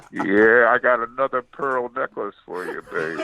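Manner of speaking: creepy come-on in a put-on lover voice; low-key sleazy sweet-talk, put on; Love Master. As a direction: croon it like a creepy seducer. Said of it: "I'm doing the Love Master".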